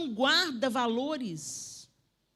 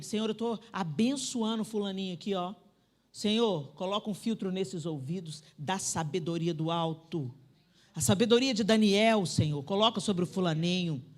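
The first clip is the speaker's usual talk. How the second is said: prayer; 5 semitones lower than usual. Shown as a demonstration. Demonstrates praying over child.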